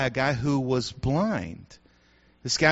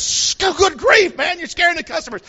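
shush, then high fast scolding; exasperated manager; 14 semitones higher, 7 dB louder than usual. Pretend. Restaurant manager hushing a shouting diner, exasperated scolding. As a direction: hush them, then rush out the complaint. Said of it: "the management comes out and says".

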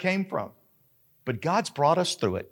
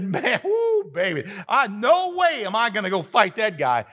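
much higher, laughing, panicked soldier; scared soldier; 6 semitones higher than usual. Pretend. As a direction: squeal like a soldier backing away from the giant. Voicing scared Israelite soldiers.

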